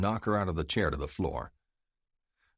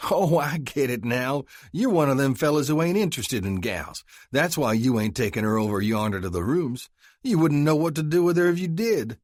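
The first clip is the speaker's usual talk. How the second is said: mocking Texan; 8 semitones higher, 5 dB louder than usual. Texan mocks Tyler after laughing.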